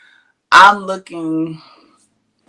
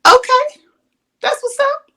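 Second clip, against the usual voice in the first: much higher, sugary mock agreement; high fake-polite okay.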